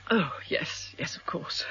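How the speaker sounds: breathless hushed whisper. Acted. Mrs Weldon whispering her answer to Miss Vane. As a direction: barely voice it, flustered. Flustered widow answers investigator.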